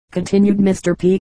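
plain reading voice

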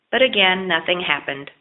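usual speaking voice

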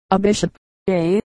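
mildly musing robber; a highwayman thinking it through; thoughtful. A narrator's reading. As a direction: weigh it over, lightly voiced. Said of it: "he said thoughtfully".

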